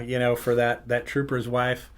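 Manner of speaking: ordinary delivery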